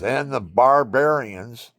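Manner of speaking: loud and slow, the pitch climbing on "barbarians"; grand statement. Retold or imitated. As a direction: draw out "barbarians" like a big proclamation. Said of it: "and I says".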